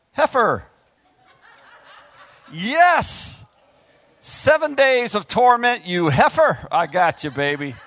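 high, loud gleeful delight; gleeful outburst. Real, not acted. Preacher delighting in Samson's insult to his wife, gleeful and loud. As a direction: savour the insult with glee. Relishes the word heifer.

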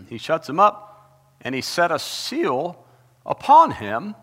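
loud, high, slow scripture proclaiming; full-voiced pulpit reading; preaching at full force. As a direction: thunder the verse out to the congregation.

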